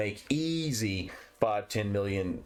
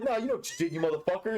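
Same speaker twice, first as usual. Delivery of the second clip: high, accusing mock taunt; hammed-up call-out of a dirty opponent; playful mock anger; mock angry fighter; 7 semitones higher, faster than usual. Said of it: "He's like".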